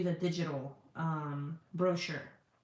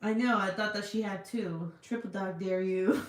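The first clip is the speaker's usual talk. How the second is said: normal talking voice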